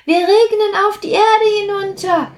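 high, fast drop voice explaining; eager explaining. Acted. Sprüh tells the others they will rain down, high and quick. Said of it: "erklärte Sprüh".

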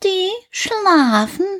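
high, slow cat voice saying they sleep; little cat. A narrator's reading. The cat calls down to Häwelmann, high and slow. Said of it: "rief die kleine Katze herunter".

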